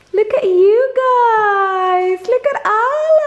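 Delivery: very high, drawn-out, squealy cooing. Real, not acted. Mother gushing over her two kids standing together. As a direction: melt over them out loud.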